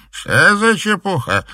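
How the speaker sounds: high, slow sleepy grumble; a drowsy old woman grumbling. A narrator's reading. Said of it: "спросонья буркнула Софья". (сонное ворчание)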